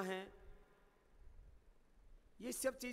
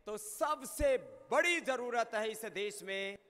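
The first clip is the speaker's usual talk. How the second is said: impassioned sermon; 3 semitones higher, 6 dB louder than usual. Acted. Declaims the core message. (धीमी, ऊँची और ज़ोरदार उद्घोषणा)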